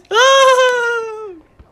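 long, high, loud squeal; excited squeal. Pretend. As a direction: squeal with over-the-top excitement. Excited squeal after saying so.

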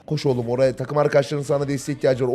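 ordinary talk

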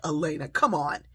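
loud, incredulous retold reaction; big, punchy disbelief; excited disbelief. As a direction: exclaim it as if you can't believe it. Grandmother retells her amazed reaction.

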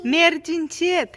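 high, slow call of caution. Real, not acted. Parent telling a child to walk slowly, cautioning. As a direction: call it out high, stretching the words.

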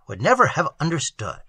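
ordinary delivery, nothing marked

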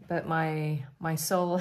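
ordinary talk

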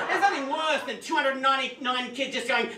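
ordinary delivery

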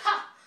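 a short mocking "Ha!"; loud scornful burst of laughter. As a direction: bark out one sneering laugh. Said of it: "he laughed and he was like".

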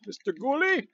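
high, teasing sing-song call; playful calling. Pretend. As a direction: coax the monsters out playfully.

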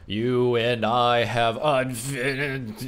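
drawn-out words sinking into a death groan; slow, strangled speech cut off by collapse; dying figure's last words. Acted. Revived enemy collapsing before the player, slow dying groan.

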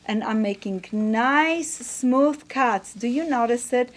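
usual speaking voice

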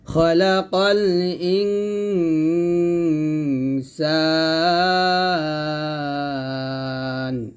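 slow chant, long nasal hum on "ইন" in "ইনসান"; ikhfa ghunna. Shown as a demonstration. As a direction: hold the nasal n, then stretch "সান" in "ইনসান". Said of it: "এখানে ইকফা গুণা করবেন".

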